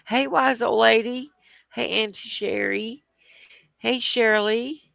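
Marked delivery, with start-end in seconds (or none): none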